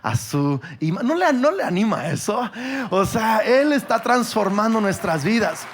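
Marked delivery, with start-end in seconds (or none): 2.9-5.6 loud, high and thrilled; excited exclamation. Real, not acted. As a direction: proclaim it with joy, louder. Preacher exclaims to congregation. (exclamación entusiasta y fuerte)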